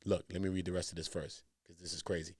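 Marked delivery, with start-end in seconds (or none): none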